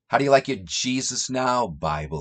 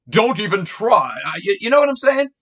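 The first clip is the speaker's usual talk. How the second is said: much higher, louder, fast sneering taunt; mock arrogance; 10 semitones higher, 6 dB louder, faster than usual. Mimicking a boastful Jesus to listeners, high sneer. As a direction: squeak out a smug dismissal. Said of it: "That's what Jesus is doing".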